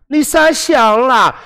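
put-on high, slow teasing girlfriend; girlfriend's voice. Shown as a demonstration. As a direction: drawl it out like a coy girlfriend. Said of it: "你女朋友就会说".

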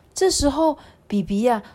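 usual speaking voice

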